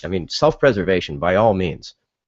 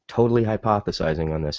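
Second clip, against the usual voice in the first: usual speaking voice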